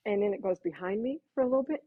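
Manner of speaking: ordinary talk, nothing marked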